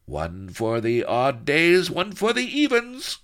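high, gleeful sidekick, mildly chortled; chortling henchman. A narrator's reading. Enchilada approves his boss's plan, mildly chortling. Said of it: "chortled Enchilada".

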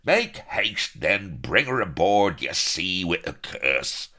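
bellowing high and loud; thundering. A narrator's reading. Captain thunders at the sentries, loud and high. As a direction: roar the order across the quay. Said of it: "Yardley thundering".

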